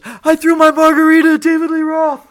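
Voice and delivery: very high, full-out holler; imitated triumphant yell. Drunk fan dragged past his friend by security, triumphant yell. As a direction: crow it loudly while being hauled away. Retells kicked-out friend boasting in passing.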